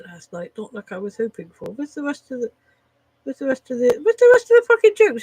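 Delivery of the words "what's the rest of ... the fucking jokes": frustrated outburst. Real, not acted. Frustrated searching for jokes.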